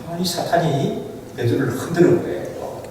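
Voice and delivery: ordinary talk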